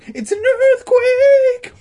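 panicked scream. A narrator's reading. Boys raising the alarm.

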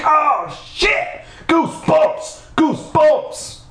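very high squealed exclamations; mock shocked shouting. Reader reacting to chapter cliffhanger, mock scream.

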